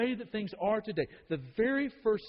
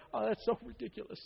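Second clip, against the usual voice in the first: mild mock chuckle; 3 semitones higher, 3 dB quieter than usual. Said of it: "began to kind of chuckle".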